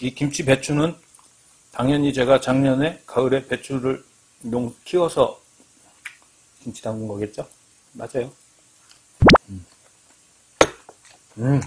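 chewing while talking; talking between bites; mouth full. Real, not acted. Seen 0: Eats with chopsticks, lifts food to mouth repeatedly while talking.

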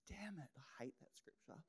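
unvoiced conspiratorial whisper; whispered aside. Real, not acted. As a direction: hush it like a confession to the front row. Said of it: "whispered aside".

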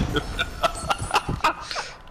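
squeaky, breathless laugh; high breathy laugh. Real, not acted. Laughing at the story. (rire aigu et essoufflé)

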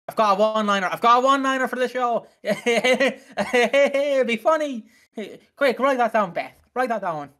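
hammed-up writer pitching a joke; imagined writer. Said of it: "That's how I imagine he".

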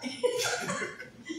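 a sudden high burst of laughter. Real, not acted. Laughs at himself in the mirror.

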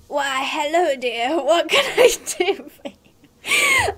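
granny cracking into laughter; hammed-up granny losing it to giggles. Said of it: "I can do my granny voice".

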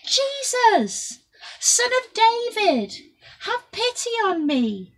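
high, loud pleading cry. A narrator's reading. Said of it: "Bartimaeus only shouted louder".

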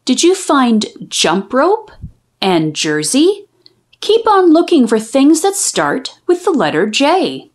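put-on gentle teacher quizzing young readers; teacher asking children. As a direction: coax little ones to look and answer.

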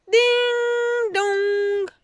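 sung high ding dong like a bell; doorbell chime. A narrator's reading. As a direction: ring it out like a doorbell. Doorbell in the story. (sonnette chantée)

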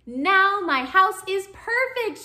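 high, loud, slow triumphant cheer. A narrator's reading. Hermit Crab delights in his finished house, cheering. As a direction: proclaim it joyfully, drawing out the words. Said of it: "cheered Hermit Crab".

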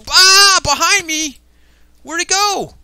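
loud scream into high, frantic questions; scream, then hunt frantically for the danger; startled panic. Pretend. Dragon behind him in game.

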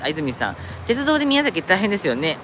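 ordinary talk, nothing marked

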